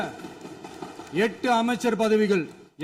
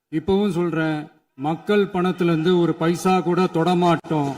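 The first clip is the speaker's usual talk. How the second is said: ordinary talk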